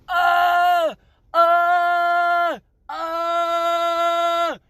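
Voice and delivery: long, high held "ah" wail; imitated screaming. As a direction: mimic agonized screams on one sustained note. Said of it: "like, screaming and crying".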